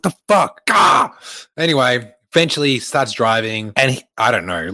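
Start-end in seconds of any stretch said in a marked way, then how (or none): 0.0-1.1 high-pitched yelp of frustration; exasperated outburst. Retold or imitated. Passenger fed up with the driver's excuse, exasperated outburst. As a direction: burst out high and exasperated, ending in a yell.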